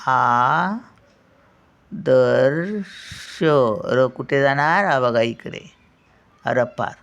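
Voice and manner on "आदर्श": आदर्श drawn out very slowly, ending in a clear र्श; very slow sounding out. Shown as a demonstration. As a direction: stretch आदर्श for the class to copy. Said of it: "काय बोलतो तो विद्यार्थी?".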